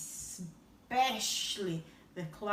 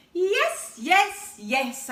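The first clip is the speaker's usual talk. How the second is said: eager yes yes yes; 7 semitones higher, 12 dB louder, much slower than usual. Acted. The child giving in to Gideon, loud and eager. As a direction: blurt out the yeses.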